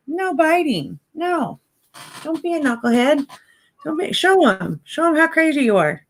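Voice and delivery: playful scolding; high-pitched dog talk; high puppy talk. Real, not acted.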